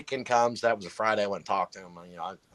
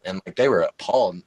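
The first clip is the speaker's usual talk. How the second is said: normal talking voice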